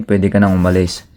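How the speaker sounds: ordinary talk, nothing marked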